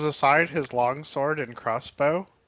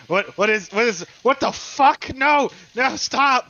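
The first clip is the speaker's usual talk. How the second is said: rising panicked yell, louder and higher; trapped man yelling; 8 semitones higher, 6 dB louder than usual. Acted. Trapped bandit yells in outrage from below, loud and high. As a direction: bandit shouts up from the hole at the man peeing on him. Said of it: "yells fucking from in the hole".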